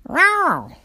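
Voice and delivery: high, loud cat yowl; angry cat yowl. Acted. Cat grabbed by the tail, yowling. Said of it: "said the cat".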